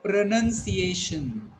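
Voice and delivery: "pronunciation" drawn out slowly, NUN in the second syllable; slow, deliberate teaching model of "pronunciation"; correct model pronunciation. Shown as a demonstration. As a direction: repeat "pronunciation" slowly as pruh-nun-see-AY-shun. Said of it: "I repeat".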